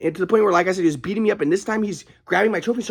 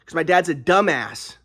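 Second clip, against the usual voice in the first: ordinary talk, nothing marked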